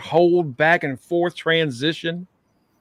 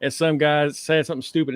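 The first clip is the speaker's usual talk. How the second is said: ordinary talk, nothing marked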